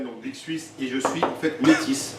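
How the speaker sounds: usual speaking voice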